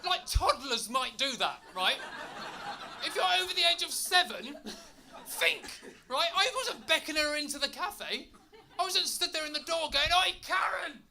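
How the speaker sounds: high, loud laughing rant; exasperated comic outburst; ranting shout. Acted.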